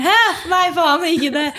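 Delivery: high startled yelp breaking into a laugh; mock panic at a door that won't open; flustered surprise. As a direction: laugh through the embarrassment of the stuck door. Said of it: "bare sånn her".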